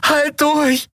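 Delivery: high, anguished urging; tormented voice. A narrator's reading. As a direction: urge her on through agony. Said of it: "versprach Andriel mit gequälter Stimme".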